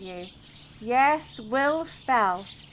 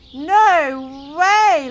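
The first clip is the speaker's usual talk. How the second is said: laughing cowboys; 8 dB louder than usual. A narrator's reading. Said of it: "laughed the cowboys".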